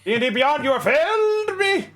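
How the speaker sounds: very high, hammed-up lament at the app; mock dramatic despair. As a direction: rail at the app like a tragic hero. Mock despair at lagging tool.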